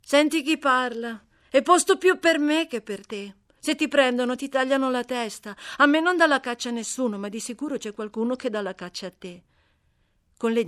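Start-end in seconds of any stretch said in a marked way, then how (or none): 0.1-9.4 put-on a louder, higher, brisk voice for the scrappy girl. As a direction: bold and cheeky, talking back fast. Amy answers the runaway slave. (ragazza sfacciata, voce più alta e forte)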